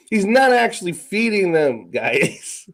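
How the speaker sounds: ordinary talk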